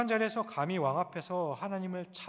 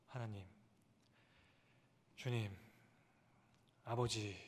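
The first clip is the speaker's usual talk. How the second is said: soft crying out; 6 semitones lower, 6 dB quieter than usual. Shown as a demonstration. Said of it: "이렇게 부르짖게 되죠".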